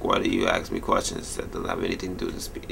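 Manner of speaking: mock quiet complaint. Him grumbling at the exam questions, low and soft. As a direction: mumble it low under your breath. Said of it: "I was like".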